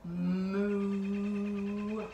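long, held cow moo; playful sustained moo. Pretend. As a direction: moo like a cow for small children. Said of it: "like a cow".

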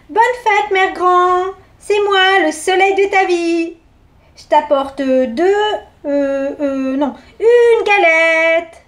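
put-on a sweet girl fumbling her gift.

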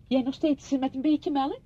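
high, loud domestic offer; calling out. Acted. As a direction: call over to her while making coffee. Calling while making coffee, offering milk.

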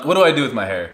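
ordinary talk, nothing marked

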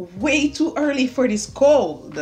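fired-up rant about early cold weather; passionate complaint. Real, not acted. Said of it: "I said that with so much passion".

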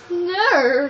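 loud, high mock refusal; acted refusal. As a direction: blurt it out high and loud, play-acting. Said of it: "And I'm just like".

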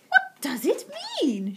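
high, slow, puzzled asking; baffled question. Acted. Missus Silver puzzled by the paper, high baffled question. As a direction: ask in drawn-out bewilderment. Woman baffled by the strange words.

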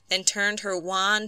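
ordinary delivery, nothing marked

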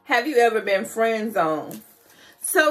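ordinary talk

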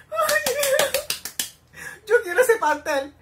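longing squeal. Real, not acted. Fan begging for the show's cake, high eager whine.

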